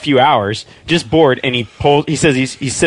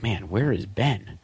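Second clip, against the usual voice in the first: muttered worry; 11 dB quieter, slower than usual. Retold or imitated. Wondering aloud at dinner.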